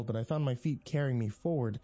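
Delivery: ordinary delivery, nothing marked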